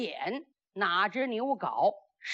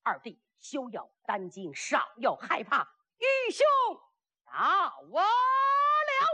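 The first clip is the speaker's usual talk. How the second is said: loud heroic call; 5 semitones higher than usual. Acted. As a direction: shout climbing to a long high note. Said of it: "有人高声喊喝".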